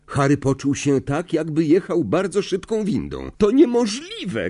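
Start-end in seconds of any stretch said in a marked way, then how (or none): 3.4-4.5 high-pitched squeal of disbelief; much higher, piping exclamation; shrill cry. A narrator's reading. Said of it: "krzyknęła piskliwie Hermiona".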